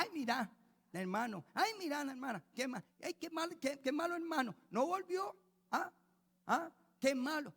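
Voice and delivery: high, loud, whiny gossip; gossiping churchgoer. Retold or imitated. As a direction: mimic a nosy tattler clucking. Mocking gossiping church members.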